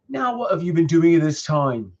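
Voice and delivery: mildly cross farmer in a put-on accent; farmer's accent. A narrator's reading. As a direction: put on a hint of a scolding farmer. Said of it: "Sorry if my accent changes".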